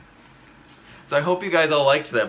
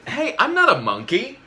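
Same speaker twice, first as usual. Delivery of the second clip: much higher, louder, stress on "not"; squeaky indignant denial; indignant protest; 9 semitones higher, 4 dB louder than usual. Pretend. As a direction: protest with mock outrage. Reader protesting the book.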